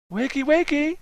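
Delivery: high, singsong wake-up call. A narrator's reading. Grad student at the mic, calling out. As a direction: call it out over a sleepy hall. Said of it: "she called".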